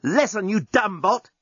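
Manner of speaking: angry. Acted. Journalist shouting at bot.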